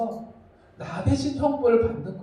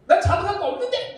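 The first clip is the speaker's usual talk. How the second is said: put-on protesting child; 10 semitones higher, 3 dB louder than usual. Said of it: "여러분들은 왜".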